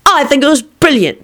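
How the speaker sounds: proud announcement. A narrator's reading. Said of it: "Timothy announced".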